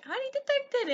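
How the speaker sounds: very high catchphrase voice; imitated video voice. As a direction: mimic the character way up high.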